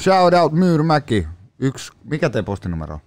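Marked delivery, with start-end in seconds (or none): 0.0-1.3 loud, drawn-out shout-out, pitched up; big, slow hype call. Real, not acted. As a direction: call it out slowly to the whole neighbourhood. Giving a shout-out to the neighbourhood.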